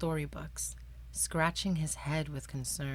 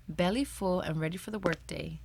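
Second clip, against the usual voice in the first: plain reading voice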